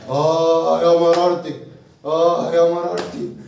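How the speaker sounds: slow loud groaning lament; mock lament. Father suffering over son's deals, slow loud lament.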